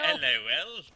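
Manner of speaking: loud, badly faked New Zealand greeting; New Zealand accent. Echoing the co-caster's greeting, in a failed New Zealand accent. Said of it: "We're doing a little bit New Zealand accent".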